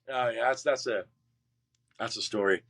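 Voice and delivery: usual speaking voice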